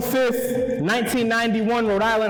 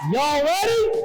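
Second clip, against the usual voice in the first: hype shout; 6 semitones higher than usual. Real, not acted. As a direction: pump up the crowd.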